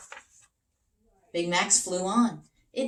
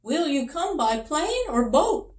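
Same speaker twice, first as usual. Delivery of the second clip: high, louder eager question; king asking; 10 semitones higher, 7 dB louder than usual. Acted. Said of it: "the king asked".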